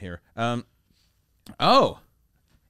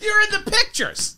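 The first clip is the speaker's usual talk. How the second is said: mock shout. Groom interrupting his own vows, laughing mock shout. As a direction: yell it like spotting a celebrity. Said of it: "do you take this woman?".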